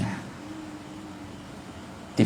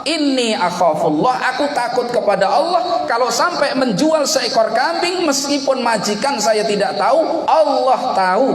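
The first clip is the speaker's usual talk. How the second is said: fearful servant; 11 semitones higher than usual. Retold or imitated. Slave explains his fear of Allah, high earnest plea. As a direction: speak as a slave who fears God. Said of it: "rasa takutnya kepada Allah".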